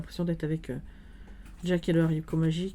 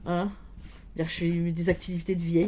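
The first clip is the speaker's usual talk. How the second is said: normal talking voice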